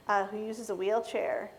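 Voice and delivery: normal talking voice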